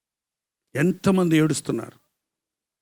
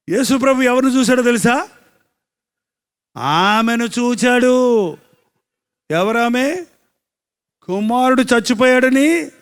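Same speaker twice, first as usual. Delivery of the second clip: raised, drawn-out sermon delivery; full-force preaching; 7 semitones higher, 6 dB louder, slower than usual. Acted. Preacher telling the congregation of a bereaved mother, full-force preaching. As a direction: preach it out, higher than normal talk.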